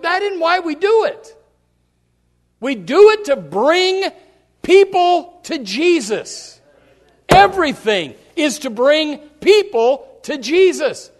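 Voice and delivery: loud, climactic sermon delivery; rally the church to a peak; full-force preaching. Acted.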